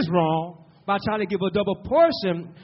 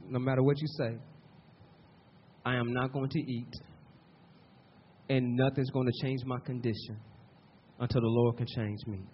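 quiet grief; 8 semitones lower, 9 dB quieter than usual. Acted. Hannah's reply voiced in a story.

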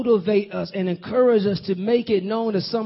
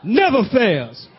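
louder and higher, building hard; full-force preaching; 5 semitones higher, 5 dB louder than usual. Acted. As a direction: drive it home to the congregation.

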